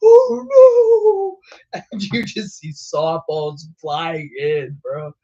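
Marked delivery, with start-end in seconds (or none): 0.0-1.8 high, loud mock panic; hammed-up "oh no" of the chaser; mock yell. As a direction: yelp it like the hail just hit. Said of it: "He's like".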